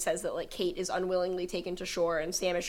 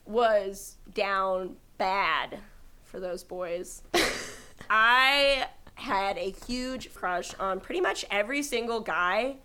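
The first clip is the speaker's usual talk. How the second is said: usual speaking voice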